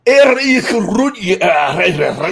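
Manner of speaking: put-on thick Scottish accent, slow and mumbled; garbled Scottish old man; old Scotsman mumble. Old man in a tam o' shanter mumbling to a kid in Scottish accent. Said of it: "he's like".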